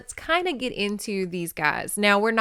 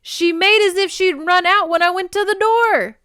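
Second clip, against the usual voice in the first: high, loud Scottish protest; defensive protest; 12 semitones higher, 9 dB louder than usual. A narrator's reading. Ross justifying himself to the circle, defensive shout. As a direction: belt it out as a hammy defence. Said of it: "He glanced around the circle defensively".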